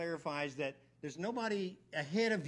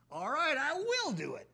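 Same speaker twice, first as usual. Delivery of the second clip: eager agreement; 10 semitones higher, 4 dB louder than usual. Acted. Kinsman eagerly agrees to redeem.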